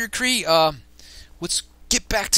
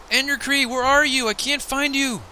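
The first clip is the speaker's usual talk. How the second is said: high, raised voice calling for a partner; 10 semitones higher, 5 dB louder, slower than usual. Pretend. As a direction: call out for someone you've lost. Calling for lost game partner.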